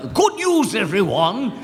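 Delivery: put-on high, loud, quavering old man; old professor voice. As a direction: announce it like a doddering professor. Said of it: "I'll do some voices".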